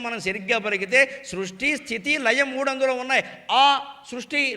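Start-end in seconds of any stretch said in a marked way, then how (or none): 3.5-3.9 ఆ drawn out with open mouth; demonstrated opening ఆ of Om; open-mouthed vowel. Shown as a demonstration. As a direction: open wide and hold ఆ. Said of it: "నోరు తెరిచావు".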